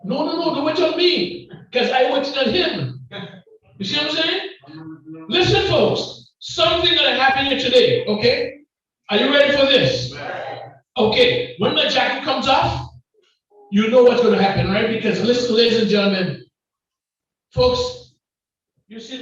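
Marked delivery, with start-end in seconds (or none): 0.0-14.2 loud, high hype to the crowd; rising preacher shout; fired-up preaching. Real, not acted. 17.5-18.2 sudden high shout of folks; preacher's sudden yelp; cry out. Real, not acted. Preacher cries out to crowd.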